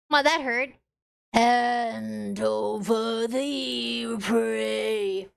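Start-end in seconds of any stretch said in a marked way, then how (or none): 1.3-5.3 a hint of a slow, low predator growl; lightly voiced menacing cat. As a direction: drag it out like a hungry cat. Cat demands the prey.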